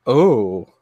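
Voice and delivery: flat, let-down little oh; a deflated, unimpressed oh; a mild, disappointed oh; underwhelmed oh. Retold or imitated. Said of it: "it was a kind of a".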